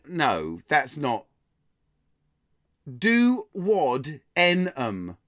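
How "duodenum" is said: "Duodenum" very slowly as doo, wod, EN, um; sounded out. Shown as a demonstration. Quiz host puzzling out a listener's suggestion, "Duodenum" slowly syllable by syllable. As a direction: break "Duodenum" into drawn-out pieces, do-wod-en-um.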